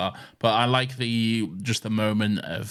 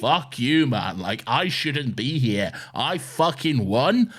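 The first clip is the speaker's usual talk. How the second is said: imitating an angry outburst, louder and higher, dragging out the words; spitting mock rage at the host; furious outburst; 5 semitones higher, 4 dB louder, slower than usual. Retelling Johanna swearing at Caesar in mock fury.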